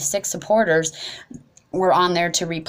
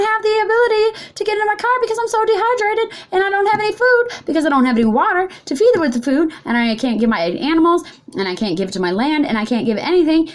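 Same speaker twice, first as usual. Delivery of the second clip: rising desperate outcry about the land; impassioned rant; 13 semitones higher, faster than usual. Real, not acted. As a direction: pitch soaring, words tumbling out fast. Passionate rant about losing water.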